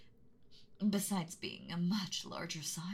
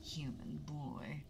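menacing, hushed dragon threat, drawn out; mean angry dragon voice. Acted. Dragon to a trespassing boy, menacing hush. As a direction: loom over him like a beast in its lair. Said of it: "mean, angry dragon voice".

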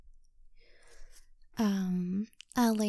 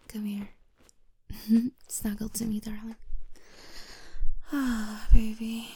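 ordinary talk, nothing marked

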